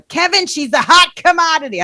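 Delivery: a bad, overdone Boston accent; loud, hammy Boston accent. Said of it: "my shitty Boston accent".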